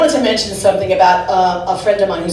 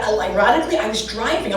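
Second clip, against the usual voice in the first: normal talking voice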